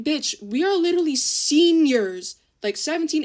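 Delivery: high, loud, drawn-out indignation; retold outraged telling-off; exasperated disbelief. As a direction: stretch it out, appalled at the younger girl. Retold scolding of a thirteen-year-old.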